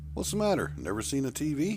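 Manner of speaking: lightly voiced stern leader, amused; underground leader voice. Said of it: "He looked in that direction".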